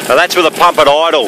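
loud, high call over the pump; calling over noise. Real, not acted. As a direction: call it out over a roaring engine.

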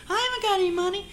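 very high woman's voice. Retold or imitated. Waitress tells the robber she has no money, very high. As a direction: plead it high and prim.

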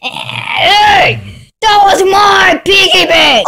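yelling about the smashed piggy bank; angry shout. Acted. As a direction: shout your outrage. Upset over broken piggy bank.

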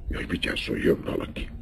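hushed, urgent whisper; alarmed whisper. Acted. Countess whispering a warning as someone comes. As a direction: warn him under your breath. Someone approaching the secret lovers.

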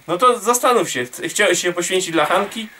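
usual speaking voice

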